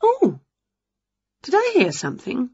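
high, surprised gasp and question; quick, high wondering; startled curiosity. A narrator's reading. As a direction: prick up your ears in surprise. Winnie hears a strange sound.

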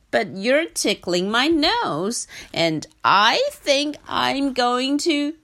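giraffe voice. Acted. Giraffe about to sneeze.

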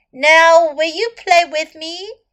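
high, loud hopeful frog; a little frog asking eagerly. Acted. Frog asks again.

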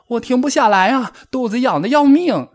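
high, loud flustered cry; desperate high-pitched squeal; panicked. A narrator's reading. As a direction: wail it, frantic from unbearable tickling. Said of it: "蹦蹦慌乱地挣扎着".

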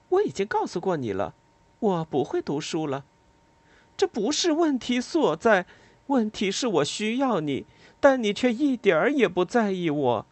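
Lila's reproach. Acted. Lila answers on the phone.

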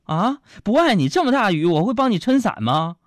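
high, loud mock-passionate protest; urgent explanation. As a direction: overplay a devoted boyfriend's denial. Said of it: "我就赶紧解释".

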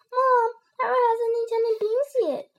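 high, loud whine to mom; high-pitched child tattletale; tattling little brother. A narrator's reading. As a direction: tell on your brother.

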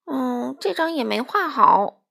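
put-on grumpy child-story complaint; complaining.

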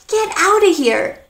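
"Get outta here" pitched high, a gasp before it; surprised delight. Retold or imitated. American hears good news, delighted surprise. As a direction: sound amazed for someone. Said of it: "when an American says to you".

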